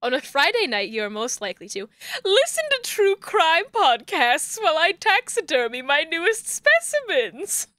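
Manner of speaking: a put-on eerie character voice; lightly ghoulish Pops impression. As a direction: read it as Pops the creepy collector. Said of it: "voicing this man like Pops".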